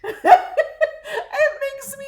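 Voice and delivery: high, loud words stretched out by laughing; real laughter. Real, not acted. Telling a friend about a mean comment, laughing hard. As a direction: barely get it out through laughter.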